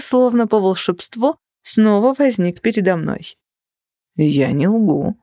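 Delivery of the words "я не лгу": put-on French accent. A narrator's reading. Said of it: "говорил с французским акцентом".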